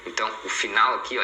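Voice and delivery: ordinary talk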